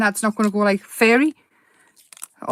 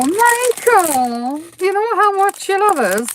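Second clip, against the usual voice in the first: higher, sweet-talking plea to husband; imitating herself buttering up Michael; wheedling; 9 semitones higher, slower than usual. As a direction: coax him into a favour.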